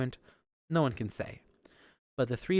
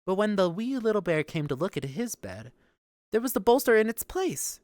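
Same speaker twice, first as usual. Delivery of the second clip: ordinary delivery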